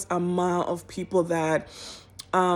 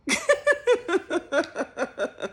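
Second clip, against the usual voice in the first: amused laugh; 12 semitones higher than usual. Real, not acted. Laughing at own story.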